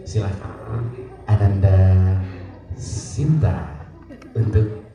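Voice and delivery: low, solemn ceremony host; hushed emotion of a sungkem ceremony; moved, sorrowful. Shown as a demonstration. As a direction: invite with tender sadness, pausing between phrases. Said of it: "perlu suatu rasa haru atau sedih ya".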